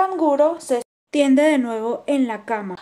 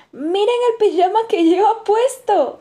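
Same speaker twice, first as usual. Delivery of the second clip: high, louder cartoon bird voice; mocking bird exclaiming; 10 semitones higher, 6 dB louder than usual. A narrator's reading. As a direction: squeal it out in a teasing bird voice. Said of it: "exclama el tordo".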